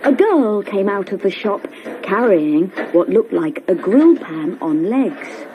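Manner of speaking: ordinary delivery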